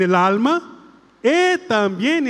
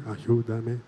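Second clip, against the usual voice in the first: lower, softer ayúdame; hushed echo; 10 semitones lower, 8 dB quieter than usual. Shown as a demonstration. Priest gives the soft response for the girl. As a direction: echo it back gently. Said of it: "Otra vez, vamos".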